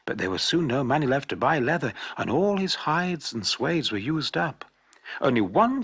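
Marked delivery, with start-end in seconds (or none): none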